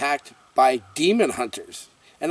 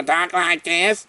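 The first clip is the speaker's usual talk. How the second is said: lightly nasal Nanny imitation; a quick mock of the Nanny voice; a hint of Fran Drescher; 4 semitones higher, slower than usual. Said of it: "That's a horrible, horrible voice".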